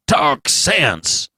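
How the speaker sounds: a hint of a sharp old doctor; doctor snaps at the nervous father. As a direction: cut him off curtly. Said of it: "said Doctor Keene sharply".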